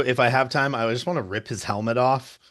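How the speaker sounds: ordinary talk, nothing marked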